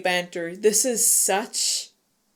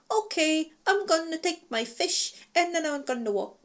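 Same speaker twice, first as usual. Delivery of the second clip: ditzy Freya; 8 semitones higher than usual. Retold or imitated.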